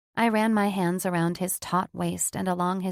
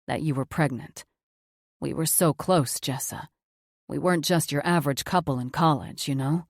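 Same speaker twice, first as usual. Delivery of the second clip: a little lower, earnest apology; lightly voiced Cam, sincere; 4 semitones lower than usual. A narrator's reading. Cam apologising to Jess, lightly lower and sincere. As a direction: say sorry from the heart, low and steady.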